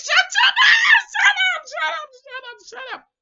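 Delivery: high shriek fading into rapid repeats; screaming. Retold or imitated. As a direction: scream it at the screen, then tail off. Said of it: "I was screaming to these".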